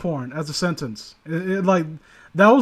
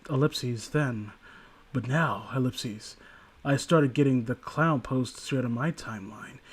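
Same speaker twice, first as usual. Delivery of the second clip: story reading; 5 semitones lower, 4 dB quieter than usual. Acted. Reads a creepy story aloud.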